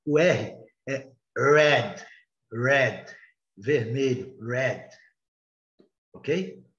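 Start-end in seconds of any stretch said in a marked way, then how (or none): 0.0-4.9 red with a curled English r, slow and clear. Shown as a demonstration. Teacher demonstrates red to Portuguese speakers. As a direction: roll the tongue back for red, not a throaty h. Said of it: "Vocês estão vendo aí".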